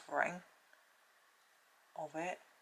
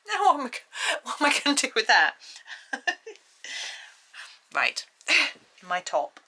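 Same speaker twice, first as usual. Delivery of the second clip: laughing; 8 semitones higher, 9 dB louder than usual. Real, not acted. Water squirted, laughing surprise.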